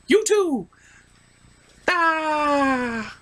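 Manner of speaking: loud high yelps; high alarmed cries. Acted. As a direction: cry out while falling. Characters cry out falling.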